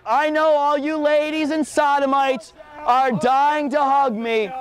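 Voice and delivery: ordinary delivery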